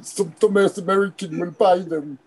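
high-pitched, badly faked French accent. Grower mocking American lentil buyers, bad French accent. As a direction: do a hammy, terrible French accent. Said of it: "that was really bad French accent".

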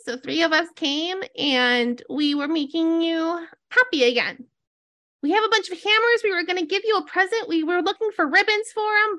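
high-pitched, earnest little creature voice; Donkey. Acted. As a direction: explain it all high, like a well-meaning critter. Voices Donkey explaining to Zotis.

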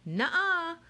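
high, bouncy cheerleader voice; perky cheerleader shout. Acted. Haley to the crowd, perky cheerleader shout. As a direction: cheer it out, peppy and bright. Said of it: "shout in her perky cheerleader voice".

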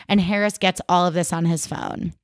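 ordinary talk

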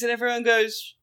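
ordinary talk, nothing marked

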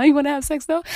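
loud insistent proposition; insistent ask. Retold or imitated. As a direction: demand it eagerly. Imitating herself asking partner.

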